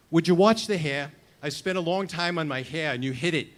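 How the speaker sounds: loud, hammed-up Brooklyn accent, jokey complaint; a mock Brooklyn tough guy protecting his hair, in Brooklyn accent; best Brooklyn accent. Narrator teasing old friend Robbie at the reunion, in a put-on Brooklyn accent. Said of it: "I say in my best Brooklyn accent".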